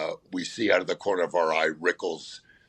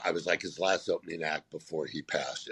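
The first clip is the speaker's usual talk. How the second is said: ordinary talk, nothing marked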